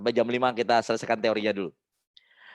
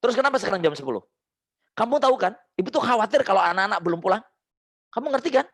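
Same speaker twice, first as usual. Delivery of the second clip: put-on demo mother questioning child; 7 semitones higher, faster than usual. Shown as a demonstration. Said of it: "Anda bilang".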